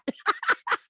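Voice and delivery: a quick high giggle. Real, not acted. Laughing at own joke.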